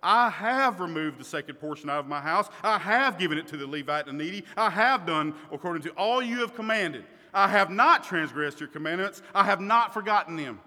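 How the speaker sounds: loud, insistent recital of a spotless record; emphatic confession. Acted. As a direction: declare it forcefully, punching each "I have". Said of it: "I am talking about an emphatic confession".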